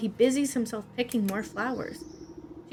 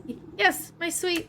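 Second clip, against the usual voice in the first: higher, halting yes from a cowed servant; stammering servant; 6 semitones higher than usual. Acted. Beekeeper answering his queen, nervous and stammering. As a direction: stutter it out, eager to please.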